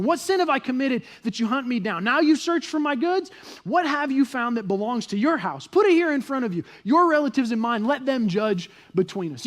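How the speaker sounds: loud, high, indignant challenge; acted sarcastic outrage; Jacob, angry and sarcastic. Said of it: "hear the sarcasm dripping from Jacob's voice".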